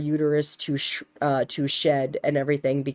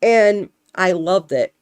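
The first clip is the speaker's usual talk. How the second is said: normal talking voice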